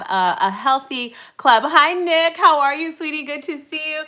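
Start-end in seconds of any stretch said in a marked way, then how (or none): 1.7-4.0 excited greeting. Real, not acted. Greeting viewer by name.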